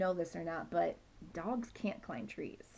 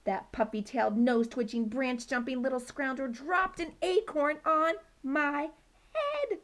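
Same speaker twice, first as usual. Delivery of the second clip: outraged rant; 6 semitones higher, 5 dB louder than usual. Acted. Outraged report.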